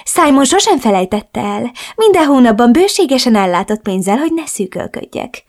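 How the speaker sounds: a hint of a reproachful mother. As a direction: reproach her daughter in a lilting mother voice. Mother voiced in story.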